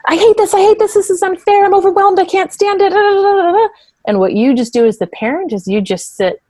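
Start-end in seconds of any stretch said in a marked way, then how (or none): 0.1-3.7 put-on much higher, louder whiny kid voice; child tantrum. Parent imitating her kid's tantrum, high and loud. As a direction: mimic a child melting down, shrill and loud. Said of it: "had its emotional kind of tantrum".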